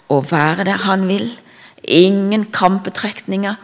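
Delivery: normal talking voice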